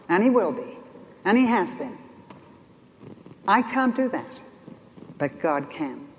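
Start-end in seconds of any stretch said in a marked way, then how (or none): none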